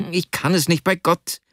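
high-pitched, helpless plea; pleading Vasja. A narrator's reading. Pinned friend refusing Arkadi, high pleading.

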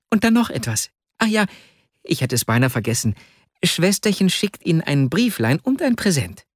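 put-on high-pitched child remembering an errand; polite young boy. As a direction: announce it like a little messenger boy. Voices the ten-year-old boy.

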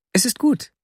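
hint of a girl answering at last; young girl's voice. A narrator's reading. The girl agreeing to the narrator's plea, lightly voiced girl. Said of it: "sagte das Mädchen".

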